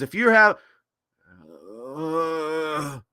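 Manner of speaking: put-on loud, halting old politician's voice; Joe Biden. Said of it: "Biden goes on there and says".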